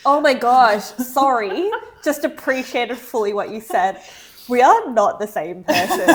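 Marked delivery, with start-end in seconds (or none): 4.5-6.1 laughing. Real, not acted. Laughs at friend's packing story.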